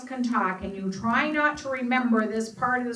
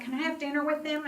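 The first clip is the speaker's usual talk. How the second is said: put-on higher, quick childlike asking; eager mother; 7 semitones higher, faster than usual. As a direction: ask like a delighted child. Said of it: "And she's like".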